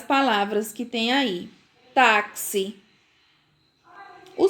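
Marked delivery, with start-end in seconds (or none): 1.9-2.8 "Táxi" said TAK-si; "Táxi" with k plus s for the x. Shown as a demonstration. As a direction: model "Táxi" with a crisp ks. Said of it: "têm o som de C".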